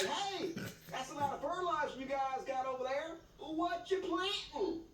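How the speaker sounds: high, put-on Southern accent; nosy Southern neighbor calling over, hammed up; pretend Southerner. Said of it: "I can pretend to be one of 'em".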